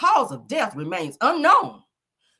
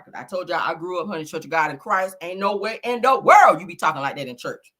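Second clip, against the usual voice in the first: ordinary talk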